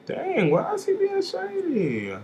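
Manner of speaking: high, slow put-on voice of offended surprise; mock shady aside. Reviewer voicing a mock offended reaction, high and slow. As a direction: clutch pearls and drawl the question up high.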